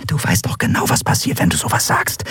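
hushed, pressing whisper; urgent whisper. Acted. Nelen covers her friend's mouth and whispers a warning.

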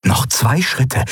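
ordinary delivery

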